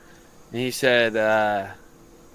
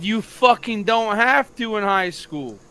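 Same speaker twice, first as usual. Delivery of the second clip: higher, slower, a bit louder telling off; retold yell at the ref; 7 semitones higher, 4 dB louder than usual. Retelling how he yelled at the ref, heated and higher. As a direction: replay the argument with the ref, heated. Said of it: "You know, I yelled".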